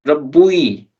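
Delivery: Rabbui with u and i as separate vowels; weird pronunciation. Shown as a demonstration. Teacher asks if it sounds normal, awkward Rabbui with both vowels. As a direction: sound out Rabbui, keeping the u before the final i. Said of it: "it sounds weird".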